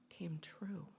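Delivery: hushed whisper on the final words. A narrator's reading. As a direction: breathe the ending softly. Storyteller's hushed happy ending.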